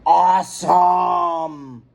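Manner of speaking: very slow, shouted Awesome; drawn-out shout. A narrator's reading. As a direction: stretch it out in wonder. Kids exclaim at lights.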